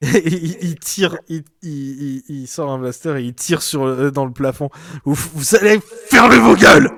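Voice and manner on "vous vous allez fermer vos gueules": loud, furious order to shut up; angry shout. Acted. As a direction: bark it over the room, enraged. Gang boss silences the crew.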